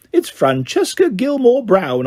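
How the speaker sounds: high, slow, snobby posh English; la-di-da posh girl impostor; silly posh voice. A narrator's reading. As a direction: announce yourself in an exaggerated posh accent. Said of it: "snobbiest, poshest voice ever".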